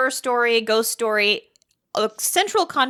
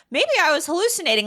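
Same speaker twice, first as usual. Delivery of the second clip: a hint of a high Pamela; lightly voiced, unsure Pamela; 8 semitones higher than usual. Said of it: "she's like".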